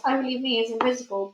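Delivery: usual speaking voice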